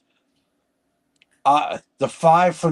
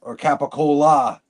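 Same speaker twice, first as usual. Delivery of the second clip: ordinary talk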